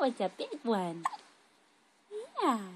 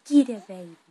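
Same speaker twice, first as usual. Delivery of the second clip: playful tickle attack; 13 dB louder, faster than usual. Real, not acted. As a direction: pounce on it loudly. Mother playfully tickling her baby.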